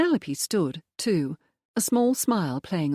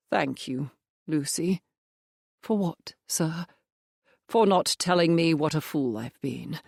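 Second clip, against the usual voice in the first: lightly voiced clergyman father, subdued; the father, rueful. A narrator's reading. Father confiding in his daughter Lucy, lightly voiced and rueful.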